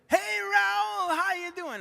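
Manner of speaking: high, raspy-free impression of [name] on the phone. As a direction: imitate [name] calling late at night, voice up high. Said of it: "He said".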